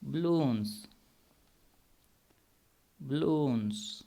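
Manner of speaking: slow, clear بلونز with a long oo; model pronunciation. Shown as a demonstration. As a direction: say بلونز as "ba-loons", drawn out. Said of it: "جہاں آپ نے بلونز لکھا تھا".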